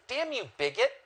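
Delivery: high-pitched, hammed-up scolding; mock indignation. Host berating viewers as bigots, mock indignant.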